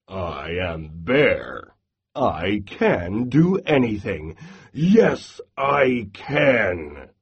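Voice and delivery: low, slow, puffed-up bear voice; bragging Bear. Acted. Bear brags about being biggest, strongest, low and slow. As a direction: boom it out like a proud big bear.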